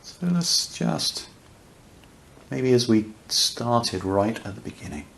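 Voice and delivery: unhurried meditation voice with long pauses; guided meditation. Real, not acted. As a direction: guide listeners gently into awareness, leaving space between phrases.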